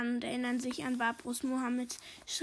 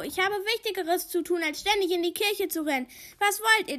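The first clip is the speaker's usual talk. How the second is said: higher, louder indignant grumbling; scolding; 6 semitones higher, 6 dB louder than usual. A narrator's reading. Barbro complains to the police inspector about being summoned, scolding. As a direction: complain crossly, pitched up and forceful. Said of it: "schimpft Barbro".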